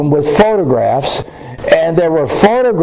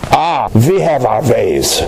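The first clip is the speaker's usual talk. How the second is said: put-on German accent; 4 semitones lower, slower than usual. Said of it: "with her German accent".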